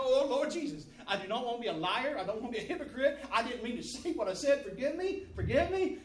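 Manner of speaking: loud, high pleading, half laughing; panicked prayer. Retold or imitated. Preacher retelling his prayer after his blunder, comic panicked pleading. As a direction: beg forgiveness, hammed up and loud. Acted panicked plea to God.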